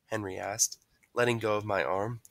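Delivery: ordinary delivery, nothing marked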